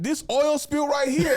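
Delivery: high, loud, slow taunting point-out; mock announcement. Cousin mocking sister's friend, loud slow taunt. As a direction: drag it out while pointing.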